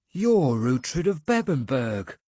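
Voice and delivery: slow amazed recognition; awed recognition of a famous lord. A narrator's reading. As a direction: realise who stands before you. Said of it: "in a tone of amazement".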